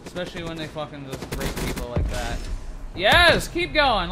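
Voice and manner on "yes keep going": high, loud burst of triumph; excited cheering. Real, not acted. Gamer urging on his streak, excited. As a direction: cheer it on at full voice.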